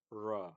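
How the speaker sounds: "ra" with a plain unrounded r; plain r sound. Shown as a demonstration. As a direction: say "ra" with flat lips. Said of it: "if I put this separately".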